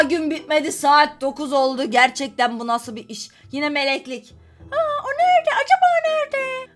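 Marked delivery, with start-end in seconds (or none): 4.7-6.7 mock sing-song search. Feigned search for the lover.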